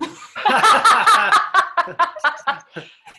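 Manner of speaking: loud, high burst of laughter; laughing. Real, not acted. Laughing after mimicking her boyfriend for the host, loud high laugh. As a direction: crack up at your own impression. Cracking up at own impression.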